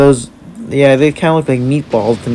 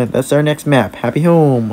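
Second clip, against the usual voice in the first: usual speaking voice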